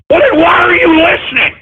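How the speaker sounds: high, shouted cry of fury; hammed-up wounded yell; tearful rage. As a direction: scream back at the haters. Raging at critic viewer.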